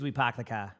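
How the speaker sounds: Boston accent, no r in park and car. Shown as a demonstration. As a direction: drop the r, Boston style. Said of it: "in Massachusetts".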